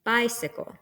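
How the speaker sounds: "Bicycle" with the first syllable stressed; BI-cy-cle, weight on the start of "Bicycle"; dactylic stress. Shown as a demonstration. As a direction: hit "Bi" in "Bicycle", then two light syllables. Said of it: "one stressed syllable followed by two unstressed".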